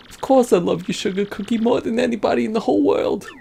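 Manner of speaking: lightly voiced lovestruck mobster; devoted boyfriend. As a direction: reassure your sweetheart, pitch climbing.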